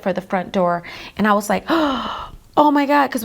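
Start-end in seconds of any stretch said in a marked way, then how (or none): 1.7-2.5 sudden alarmed intake of breath; re-enacted gasp of shock; sharp gasp. Shocked at boy darting for door. 2.6-3.1 higher, louder cry of shock; re-enacted startled outcry; retold alarm. Reliving shock as boy darts off.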